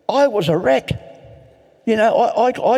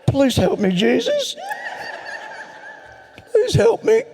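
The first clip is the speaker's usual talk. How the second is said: mock plea. Mock prayer for rescue.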